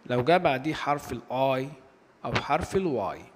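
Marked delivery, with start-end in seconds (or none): none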